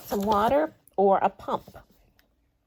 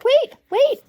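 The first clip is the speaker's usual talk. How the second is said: high, loud calling after her; frog crying out; 9 dB louder than usual. Acted. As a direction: shout after the running princess, higher and louder. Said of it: "cried the frog".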